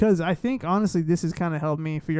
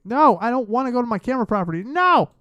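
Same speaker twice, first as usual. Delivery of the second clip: raised, high protest building to a yelp; exasperated outburst; 5 semitones higher, 7 dB louder than usual. Real, not acted. Streamer arguing with the wrong settings menu, exasperated outburst. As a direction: scold the software loudly.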